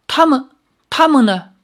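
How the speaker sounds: high, loud, hurried worry; anxious urgent question. Acted. Xiaoyao anxiously asks the old woman about his companions, high and loud. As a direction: blurt it out in alarm. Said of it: "连忙问".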